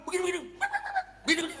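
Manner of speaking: high-pitched quarrel gibberish; mock bickering. Wife fighting back at her husband, mock bickering. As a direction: rattle off a comic squabble. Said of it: "kalau dia ngelawan".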